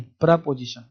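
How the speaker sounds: प्रपोजिशन said softly, short "pra" start; correct way. Shown as a demonstration. Teacher giving students the final right प्रपोजिशन. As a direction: settles on प्रपोजिशन with "pra", not "pree". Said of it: "प्रीपोजिशन नहीं".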